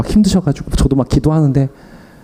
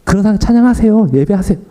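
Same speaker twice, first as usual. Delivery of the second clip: preaching at full force; 8 semitones higher than usual. Retold or imitated. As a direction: urge the congregation to worship. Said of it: "저는 설교 때는".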